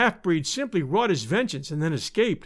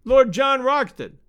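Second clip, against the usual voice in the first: high, loud, drawn-out call; slow, ringing taunting call; shouting; 6 semitones higher, 5 dB louder, slower than usual. A narrator's reading. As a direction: shout the name slowly across a chasm. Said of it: "he shouted".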